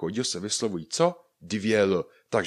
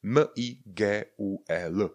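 slow letter-by-letter M I G U E L; a small gap after each letter of M I G U E L; spelling out; slower than usual. Shown as a demonstration. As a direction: dictate M, I, G, U, E, L evenly. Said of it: "mezera Miguel".

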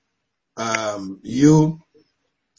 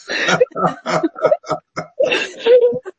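high-pitched burst of laughter. Real, not acted. Host laughs at own accent.

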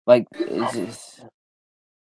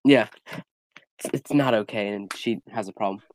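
normal talking voice

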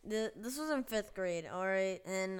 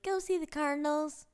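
put-on high little kid voice; third grader voice; 8 semitones higher, 4 dB louder than usual. Third grader telling break plans, high kid voice. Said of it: "freaking idiot third grader".

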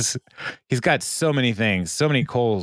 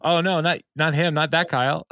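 higher-pitched, hurried denial; acted-out "oh no", pitched up; mock dismay; 6 semitones higher than usual. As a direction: imitate someone backpedaling in dismay. Acted dismay at misunderstanding in recap.

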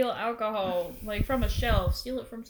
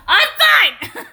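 mock insistence; 11 semitones higher, 9 dB louder than usual. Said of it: "I like that he's like".

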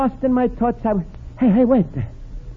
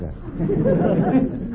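wry chuckle; 8 semitones higher, 5 dB quieter than usual. Acted. Luigi chuckles at himself.

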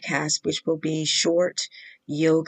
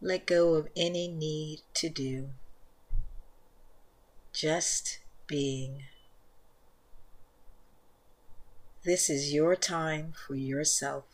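soft, unhurried meditation voice, much softer than the intro; guided meditation; 11 dB quieter than usual. Acted. Leads guided yin yoga meditation.

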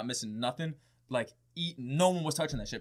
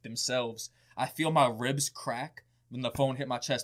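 usual speaking voice

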